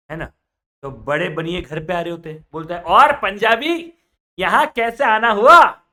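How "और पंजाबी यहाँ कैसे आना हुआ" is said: loud, slow, hearty call; loud greeting. Acted. Elder baniya loudly welcoming the Punjabi. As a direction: boom it out slowly to a guest.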